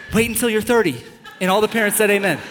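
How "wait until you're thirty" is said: normal talking voice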